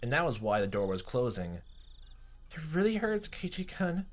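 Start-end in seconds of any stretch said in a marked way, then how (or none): 2.5-4.1 put-on high pained apologetic girl; pained Rena. Rena apologizing to Keiichi, high and pained. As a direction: plead through pain.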